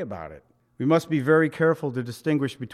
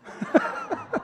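a squealing high laugh; preacher cracks up at his own punchline; high burst of laughter. Real, not acted. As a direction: break into a high laugh at the joke.

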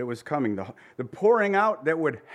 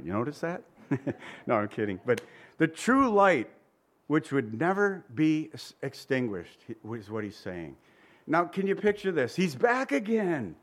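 usual speaking voice

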